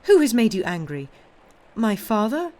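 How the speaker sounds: loud, insistent granddaughter; worried, forceful probing; urgent questioning. A narrator's reading. Granddaughter presses angry grandfather for cause.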